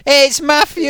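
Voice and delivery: high, loud welcoming cheer; cheerful chant of his name; excited group cheer. Pretend. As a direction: greet him like a crowd would. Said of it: "we should all go".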